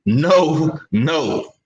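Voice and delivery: low, spaced-out refusals in a borrowed voice; lightly mimic, dropping low on each word; Act Richer. Co-host objecting to praise of Boo Boo, lightly mimicking. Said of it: "in my Act Richer voice".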